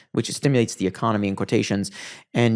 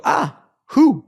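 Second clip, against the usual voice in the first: surprised; 14 semitones higher, 5 dB louder than usual. Retold or imitated. Retold surprise at meeting politician.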